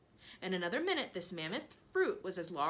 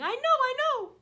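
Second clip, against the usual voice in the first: excited; 11 dB louder than usual. Acted. Aunt thrilled.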